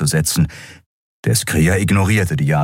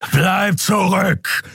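slow, raised-pitch order; forceful warning command; 13 semitones higher, much slower than usual. A narrator's reading. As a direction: order them back, drawing out each word. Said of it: "befahl Keru".

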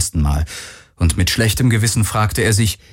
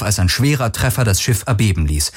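plain reading voice

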